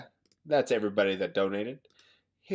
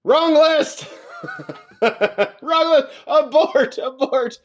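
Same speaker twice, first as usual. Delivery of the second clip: high panicked yelling; mock panic; 7 dB louder, slower than usual. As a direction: shout it like an alarm going off. Mock panic at wrong list.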